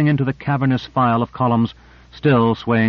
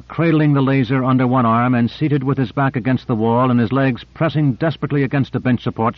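plain reading voice

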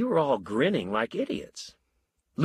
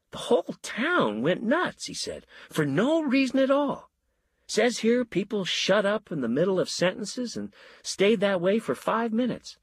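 amazed; 9 semitones higher than usual. A narrator's reading. Fred excited, far higher pitch.

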